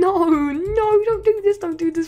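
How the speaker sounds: high, loud desperate begging; panicked pleading. Real, not acted. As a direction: beg them not to escape, frantic. Enemies escaping island.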